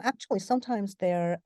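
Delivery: usual speaking voice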